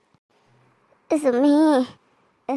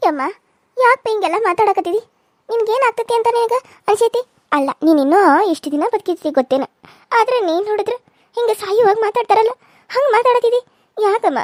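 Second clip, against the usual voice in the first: higher, louder pleading; distressed protest; 8 semitones higher, 3 dB louder than usual. Acted. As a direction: beg her to stop talking of death. Granddaughter protests to dying grandmother.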